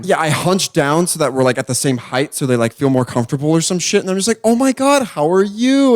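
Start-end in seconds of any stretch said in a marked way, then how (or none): 4.4-5.9 high bright sales voice; overly sweet greeting; customer service voice. Retold or imitated. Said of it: "customer service voice".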